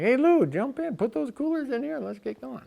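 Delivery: high, bright call from the car window; hey, get in, let's go. Retold or imitated. Friend calls to Lou from his car window, eager.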